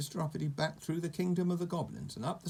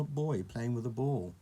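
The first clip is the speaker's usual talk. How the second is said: plain reading voice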